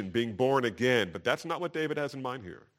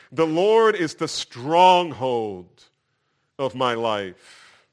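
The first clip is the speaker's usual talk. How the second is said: very slow, emphatic scripture proclamation; declaring; 9 dB louder, much slower than usual. Retold or imitated. Preacher quotes David on his stronghold, declaring. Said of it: "And next David says".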